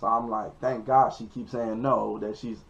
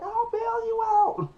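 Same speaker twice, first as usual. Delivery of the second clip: very high, slow falsetto mockery; mimicked girl; 4 dB louder, slower than usual. Girl promising help as he goes to jail, mockingly mimicked. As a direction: draw it out in a squealing put-on girl voice.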